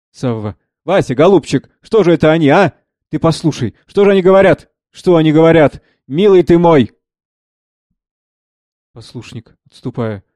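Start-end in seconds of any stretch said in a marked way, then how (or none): 0.8-6.9 acted desperate appeal, building; lightly voiced pleading Savva. Savva appeals to the novice Vasya, pleading. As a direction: plead in bewilderment.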